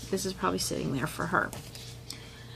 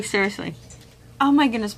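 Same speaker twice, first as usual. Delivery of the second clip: ordinary talk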